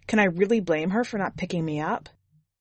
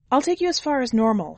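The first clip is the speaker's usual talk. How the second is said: higher, brighter-pitched woman's voice; woman driver's offer; 6 semitones higher, 4 dB louder than usual. A narrator's reading. Driver offers hitchhiker a ride to Normal, higher voice. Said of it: "she said".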